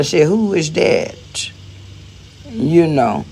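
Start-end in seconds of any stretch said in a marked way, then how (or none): 0.3-1.5 slow, innocent "who is that?"; playing dumb. Playing dumb to the girlfriend who came over, slow. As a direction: feign not knowing. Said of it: "I played dumb".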